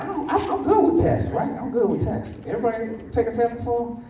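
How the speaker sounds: high, eager mock bragging; excited boast. To the officer giving the tests, a high eager boast. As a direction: pitch it up like a cocky kid. Drunk driver boasting to cop.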